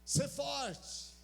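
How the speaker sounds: high, loud commanding call; voice of God. Pretend. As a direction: proclaim it like God calling Josué. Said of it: "Eu imagino que Deus falou assim". (voz de Deus chamando Josué, alta e forte)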